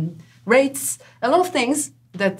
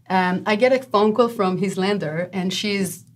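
ordinary talk, nothing marked

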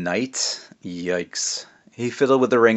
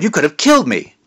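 much higher and louder angry yell of his name; furious roar; 7 semitones higher, 7 dB louder than usual. Acted. Angry witch roars at Jack, pinned to the wall. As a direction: roar it, shoving him to the wall. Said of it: "she roared".

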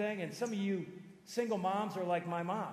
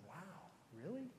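much softer, slow and taken aback; stunned, hushed; 14 dB quieter, slower than usual. Retold or imitated. Son reacts to dad's shock answer, hushed and stunned. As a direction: barely voice it, in quiet astonishment.

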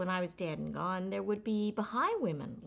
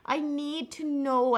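pleading; 6 semitones higher, 8 dB louder, slower than usual. Pretend. Mock desperate appeal.

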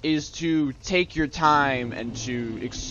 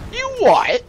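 very high, loud disbelief; hammed-up shrieky double take; mock incredulous squawk; 5 dB louder than usual. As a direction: squawk it as a baffled soldier. Soldier reacts, acted.

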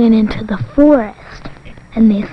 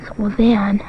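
ordinary delivery, nothing marked

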